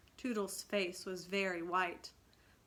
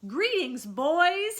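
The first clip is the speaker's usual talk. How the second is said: higher and louder greeting; cheery cry; 10 semitones higher, 12 dB louder, slower than usual. A narrator's reading. Peter greeting his band on landing, loud proud cry. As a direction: hail the boys proudly. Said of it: "he cried".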